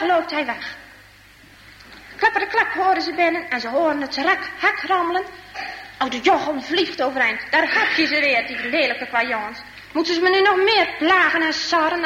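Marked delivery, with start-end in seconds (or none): none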